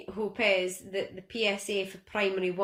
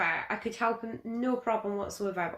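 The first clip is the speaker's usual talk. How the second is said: usual speaking voice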